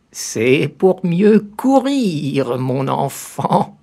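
high, very slow sly granny voice; the disguised wolf reassuring the girl. A narrator's reading. Wolf answers as grandmother.

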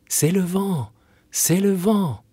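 put-on high, light childlike chorus; sing-song kids' answer; children's voices. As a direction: chant it like small children.

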